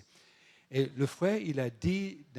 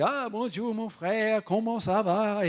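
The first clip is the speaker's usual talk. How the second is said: put-on high, sing-song comic foreign accent in French; Laurel and Hardy; 7 semitones higher, 3 dB louder, slower than usual. Mocking his accent to tease him, comic accented French greeting. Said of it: "c'est un accent de Laurel".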